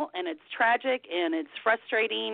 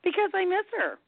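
tearful; 10 semitones higher than usual. Real, not acted. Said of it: "I can't believe I'm crying so hard".

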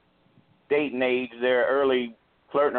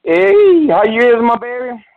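much higher and louder, a big happy hello; excited greeting; 8 semitones higher, 13 dB louder than usual. Real, not acted. Caller bursting in to greet the radio host, loud and excited. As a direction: burst onto the line to greet her.

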